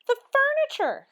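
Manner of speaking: high, loud shocked outcry; crying out at the ruined furniture; dismayed cry. A narrator's reading. Missus Rogers seeing the powdered furniture, crying out. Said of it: "she cried".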